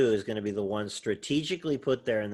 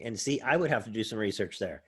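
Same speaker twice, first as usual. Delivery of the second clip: ordinary talk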